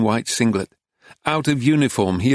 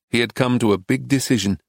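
ordinary delivery, nothing marked

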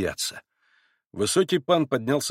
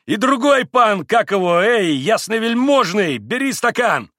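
loud, high, fussing summons; bustling call; 10 semitones higher, 8 dB louder than usual. A narrator's reading. Mitya urges the second Pole to drink, bustling loudly. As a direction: chivvy the guest along noisily. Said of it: "хлопотал Митя".

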